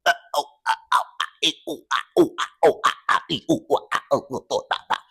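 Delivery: soft stammering vowels, fading; a hint of stuttering gibberish; mock stammer. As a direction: fumble helplessly for sounds. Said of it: "the only one who can't even".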